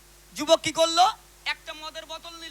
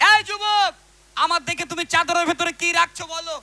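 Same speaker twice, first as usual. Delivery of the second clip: acted stern challenge; 3 semitones higher, 13 dB louder than usual. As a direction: shout it like a ruler confronting a suspect. Acted challenge by [name]. (ধমকের সুরে জোরে হাঁক)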